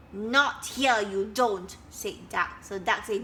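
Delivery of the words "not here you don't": louder, curt bossy retort. Acted. Duck refusing the dragonflies, loud and bossy. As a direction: shut them down firmly.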